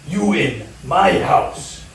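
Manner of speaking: put-on slow, deliberate Spanish-accented broken English. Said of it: "in broken English and says".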